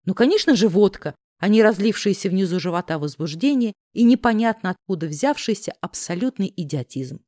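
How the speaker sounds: plain reading voice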